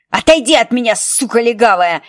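loud furious scream; girl screaming at the police officer; wailing yell. Acted. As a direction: howl it at her while kicking. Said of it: "Ники истошно вопила".

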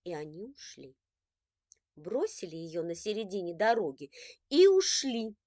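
ordinary delivery

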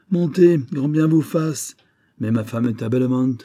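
sneering. Acted. Gus taunts Lily.

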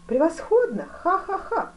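high, loud cry with acted laughter; exclaim, then laugh it out high; exultant. A narrator's reading. Duke laughs at being challenged. (ликующе, с хохотом)

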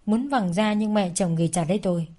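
usual speaking voice

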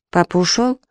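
ordinary delivery, nothing marked

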